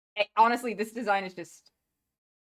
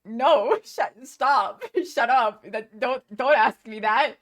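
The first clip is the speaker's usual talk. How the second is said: louder, higher, laughing mock protest; flustered protesting robot character; 4 semitones higher, 8 dB louder, slower than usual. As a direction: imitate a flustered character fending off a question. And Kibo's like.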